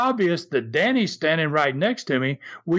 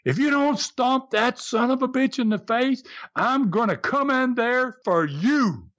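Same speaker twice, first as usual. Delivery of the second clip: retold scream; 9 semitones higher than usual. Friend threatening the wrestler from ringside, retold scream. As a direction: imitate a threatening shout without full volume. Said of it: "he screams again really loud".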